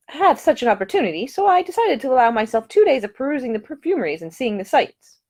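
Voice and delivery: put-on a polite, earnest young Englishwoman; young lady's voice. A narrator's reading. The lady describes her plans.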